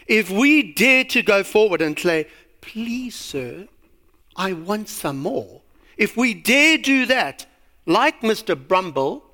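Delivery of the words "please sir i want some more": soft, timid begging of an orphan; meek plea. Acted. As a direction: plead very quietly, halting after "sir". Acting Oliver begging for more food.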